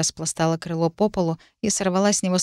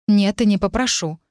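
ordinary delivery

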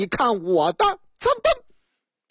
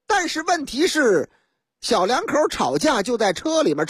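ordinary talk

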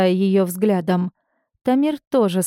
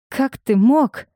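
higher, hurt and outraged question; indignant; 4 semitones higher than usual. A narrator's reading. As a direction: demand it in acted indignation. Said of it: "возмутилась я". (возмущённо)

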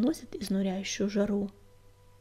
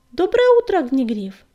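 bright greeting; 4 semitones higher, 8 dB louder than usual. Acted. Young cat greets deputy brightly.

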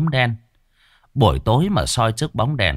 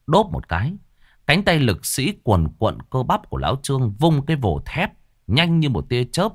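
ordinary delivery

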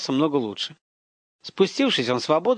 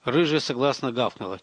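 plain reading voice

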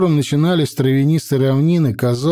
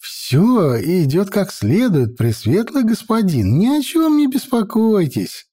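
fawning servant; 7 semitones higher than usual. A narrator's reading. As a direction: sing the usual soothing patter. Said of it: "привычно завёл свою песню Варак".

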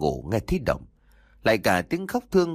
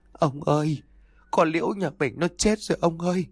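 tearful panic; 6 semitones higher than usual. A narrator's reading. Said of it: "nét mặt hốt hoảng, hai mắt đầm đìa nước mắt".

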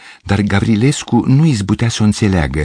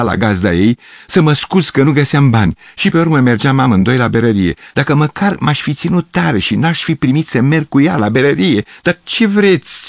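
higher voice for Gavrilescu, rambling and self-pitying; a lightly voiced melodramatic artist reminiscing; wistful old pianist; 7 semitones higher than usual. A narrator's reading. Gavrilescu recalls his hungry youth to the girls, lightly voiced and wistful.

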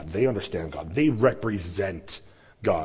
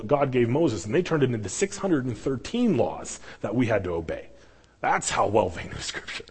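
usual speaking voice

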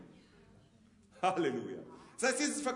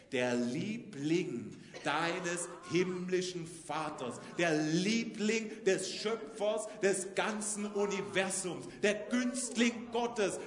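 very slow, weighty preaching; slow, emphatic sermon declaration; solemn proclamation; 6 semitones lower, much slower than usual. Acted. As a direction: lay each word down like a decree.

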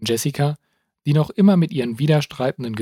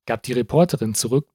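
ordinary delivery, nothing marked

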